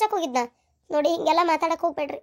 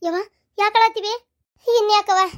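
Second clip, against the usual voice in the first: ordinary delivery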